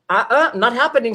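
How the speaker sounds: playful, emphatic no; retold refusal. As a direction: refuse point-blank, half laughing.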